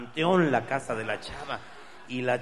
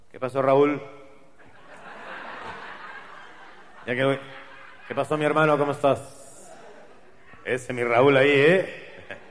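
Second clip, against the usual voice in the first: hearty greeting; 6 dB louder than usual. Acted. Friend greeting Raúl in plaza.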